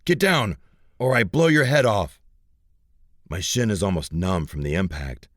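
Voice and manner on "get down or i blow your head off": loud, high barked threat; shouted threat. Acted. Thug shouting at Jack to get down. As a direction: yell it as a gunpoint warning.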